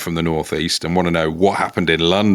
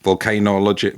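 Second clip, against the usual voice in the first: ordinary talk, nothing marked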